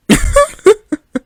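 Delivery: loud, high-pitched burst of laughter. Real, not acted.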